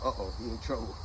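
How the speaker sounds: low, soft aside to himself; hushed thought. Retold or imitated. Kid hears his friend's father calling, hushed worried thought.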